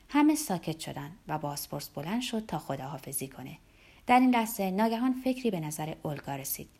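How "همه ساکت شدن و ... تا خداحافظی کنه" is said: ordinary delivery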